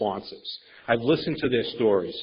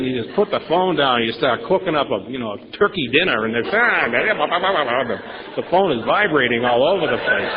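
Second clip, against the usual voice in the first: normal talking voice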